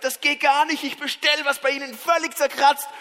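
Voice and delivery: high, loud customer rant; griping complaint. Retold or imitated. Customer on the phone about a scratched watch, griping. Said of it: "wie man motzt".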